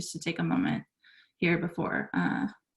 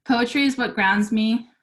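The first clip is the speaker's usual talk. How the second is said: louder, higher, deliberate speech opening; prepared speech reading; 5 semitones higher, 9 dB louder than usual. Acted. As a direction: read it out to an audience.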